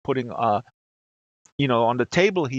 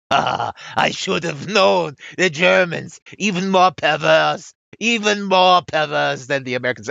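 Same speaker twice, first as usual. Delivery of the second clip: mock outrage; 3 semitones higher, 4 dB louder than usual. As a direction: imitate a scandalized French woman. Acted reply of the angry Frenchwoman.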